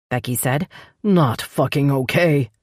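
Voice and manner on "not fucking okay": 1 growled. Acted. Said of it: "he growled".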